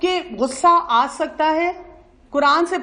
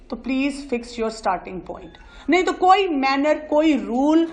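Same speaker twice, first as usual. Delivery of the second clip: usual speaking voice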